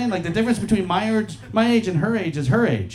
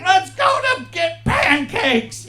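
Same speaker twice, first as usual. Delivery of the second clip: much higher, slow, dopey shout; goofy dumb friend; 12 semitones higher, much slower than usual. Retold or imitated. Dumb friend Kenny begging to go get pancakes, goofy shout. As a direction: play Kenny as an eager simpleton.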